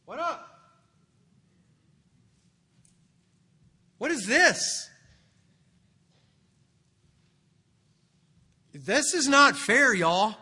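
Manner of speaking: high, loud, aggrieved complaint; indignant workers' protest. Acted. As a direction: protest like cheated laborers. Workers grumble to the master.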